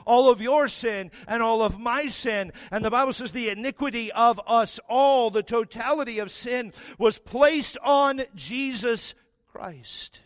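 loud, high fervent preaching; full-force sermon delivery. Acted. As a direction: preach it out to the crowd.

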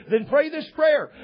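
high, loud mock instruction; hammed-up urging to get it done; pushy evangelist. Recruiter retold giving order.

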